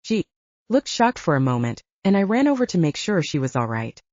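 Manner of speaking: plain reading voice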